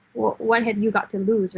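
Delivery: ordinary talk, nothing marked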